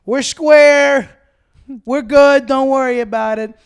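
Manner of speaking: loud, high-pitched call to make peace; loud truce. Retold or imitated. Friend returns to the group after a standoff, calling loudly. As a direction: bellow it out, long and drawn.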